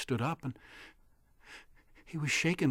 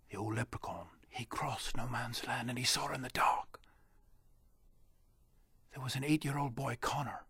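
hushed whisper; 6 dB quieter than usual. A narrator's reading. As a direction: creep through the words in a whisper. Leprechaun sneaks in dark.

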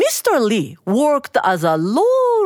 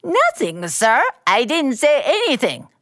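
higher, sneering mimicry of the stammer; mocking; 4 semitones higher than usual. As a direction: parrot him back with scorn. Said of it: "Mister Bao mocked".

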